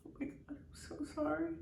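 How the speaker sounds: quick, soft, high tearful apology; crying. Real, not acted. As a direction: apologise hurriedly through real tears. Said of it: "I'm so sorry I'm crying".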